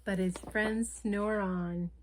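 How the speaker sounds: lively picture-book read-aloud; reading to children. A narrator's reading. Storyteller reading a picture book to children, lively. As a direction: read the story like bedtime for little ones.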